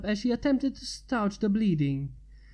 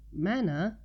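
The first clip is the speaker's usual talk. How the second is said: curious. Acted. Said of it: "Twilight asked curiously".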